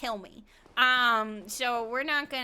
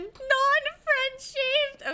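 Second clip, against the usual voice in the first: much higher, very drawn-out; playful high exclamation; 12 semitones higher, 3 dB louder, much slower than usual. Pretend. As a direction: stretch it out in a high, silly voice. Playful exclamation while steering in the game.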